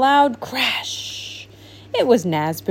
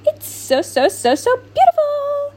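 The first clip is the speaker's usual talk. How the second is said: building to a high, thrilled squeal; delighted. Acted. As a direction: swoon over how beautiful it is.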